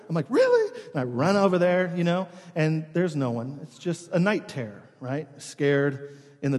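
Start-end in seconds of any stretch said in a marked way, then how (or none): 0.3-0.8 very high acted "really"; retold jolt of surprise; startled disbelief. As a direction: squeak it out in mock alarm. Acts own alarmed reply to wife.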